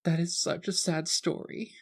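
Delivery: put-on high, soft, tearful young girl; a lightly voiced weepy teenager; teary tipsy girl. GM voicing the teary teen to Juliana, high and soft. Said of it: "has a tear in the corner of her eye".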